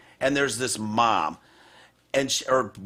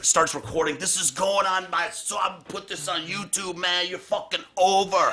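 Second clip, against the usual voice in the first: imitating ranting mom; 7 semitones higher than usual. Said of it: "a bigot and motherfucker".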